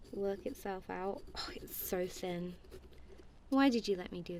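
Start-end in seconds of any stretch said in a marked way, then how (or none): 1.4-2.6 whisper. Real, not acted.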